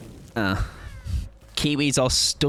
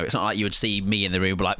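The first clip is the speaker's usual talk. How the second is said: ordinary talk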